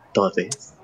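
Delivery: breathy whispered todes. Shown as a demonstration. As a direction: whisper it seductively. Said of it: "con una voz sensual como esta".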